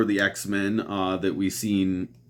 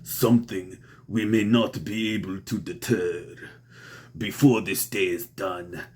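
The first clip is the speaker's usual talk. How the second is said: low, quiet, self-admittedly bad Russian accent; a lightly done menacing Russian accent for Doom; Doctor Doom's Russian accent; 3 semitones lower, 5 dB quieter than usual. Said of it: "I do a terrible Russian accent".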